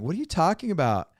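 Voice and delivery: put-on high, fast Valley Girl whine; mock Valley Girl, fast and lilting; a quick Valley Girl send-up; Valley Girl accent. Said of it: "that was a Valley Girl accent".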